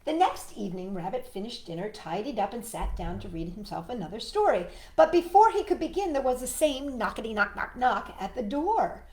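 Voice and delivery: plain reading voice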